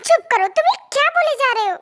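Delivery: fast, high, flustered protest; flustered hushing. Acted. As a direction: cut them off in embarrassment. Embarrassed at reminder, cuts him off.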